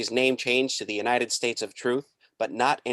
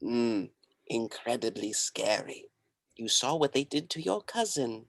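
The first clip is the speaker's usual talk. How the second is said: cult guru voice; 5 dB quieter than usual. Acted. Guru character.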